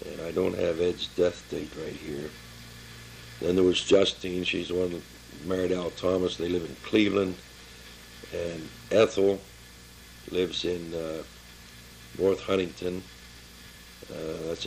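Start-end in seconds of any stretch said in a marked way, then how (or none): none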